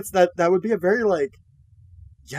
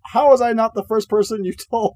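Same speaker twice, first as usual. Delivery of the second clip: higher, louder teasing whine; playful complaint; 6 semitones higher, 4 dB louder than usual. Retold or imitated. Husband teasing wife over pregnancy news, playful whine. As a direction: rib her about it, half joking.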